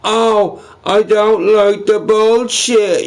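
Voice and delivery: high, whiny mocking voice; whiny troll mockery. Streamer mocking a complaining troll, high whiny voice. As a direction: sneer it out like a crybaby troll.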